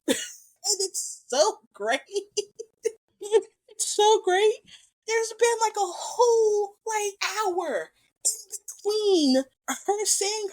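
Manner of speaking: high, squeaky gushing through real laughter; overcome with delight. Real, not acted. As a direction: gush helplessly over a favourite moment, near tearful laughter. Overcome by favourite scene, laughing.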